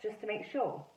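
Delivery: ordinary delivery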